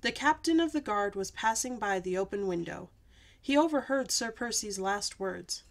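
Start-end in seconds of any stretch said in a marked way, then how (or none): none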